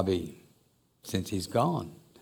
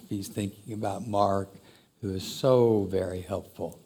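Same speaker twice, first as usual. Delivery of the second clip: ordinary delivery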